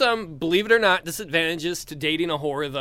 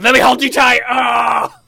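battle-cry shout; 7 semitones higher, 11 dB louder than usual. Acted. Extreme cuddler charging again.

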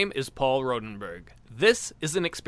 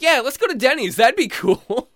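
higher and louder naive enthusiasm; clueless and thrilled about food; oblivious eager voice; 9 semitones higher, 7 dB louder than usual. Retold or imitated. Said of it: "I'm like".

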